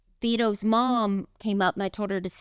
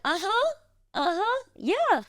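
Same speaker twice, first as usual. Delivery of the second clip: much higher-pitched, fake following along; feigned understanding; 12 semitones higher than usual. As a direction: play along in a high voice as if you understood. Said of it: "I had no idea what they were talking about".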